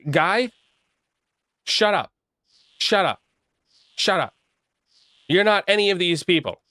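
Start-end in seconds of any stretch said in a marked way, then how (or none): 0.1-4.3 higher, fed-up shut up; exasperated shut up. Retold or imitated. Telling the schizo friend to stop, higher and exasperated. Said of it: "and I'm like".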